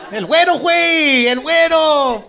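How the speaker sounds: put-on high, loud, slow Spanish-accented call; mock Mexican Spanish. Comic calling himself güero in mock Spanish accent. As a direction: shout it like a Mexican buddy teasing.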